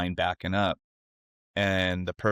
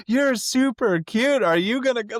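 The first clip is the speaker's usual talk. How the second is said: mocking taunt; 5 dB louder than usual. Truck driver needling the crying girl, loud mocking sweetness. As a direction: imitate a driver needling with fake compliments.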